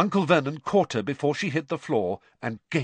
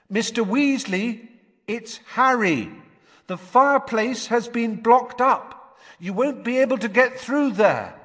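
calling through a wall; 12 semitones higher, 4 dB louder than usual. A narrator's reading. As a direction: call out clearly through the boarded fireplace.

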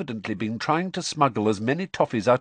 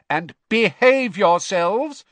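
slow, loud call after them; 13 semitones higher, 8 dB louder, slower than usual. A narrator's reading. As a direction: call after departing sons. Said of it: "she called after the twins".

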